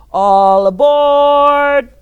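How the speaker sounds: long, loud conductor's call. Acted. Teacher playing the conductor for the children, loud drawn-out call. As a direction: ring out all aboard like a train conductor.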